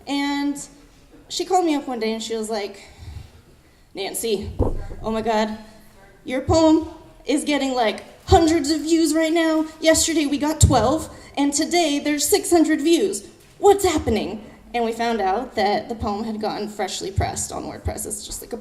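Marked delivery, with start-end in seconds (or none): 3.9-14.4 louder, higher, imitating a thrilled caller; excited retelling. Friend phoning the poet with news, excited retelling. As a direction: build the amazement on every phrase.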